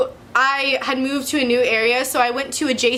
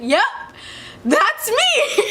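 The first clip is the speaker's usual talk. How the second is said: a much higher chuckle on "that's me"; chuckling; 11 semitones higher, much slower than usual. Retold or imitated. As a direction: laugh it off, high and amused. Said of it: "I kinda just chuckled".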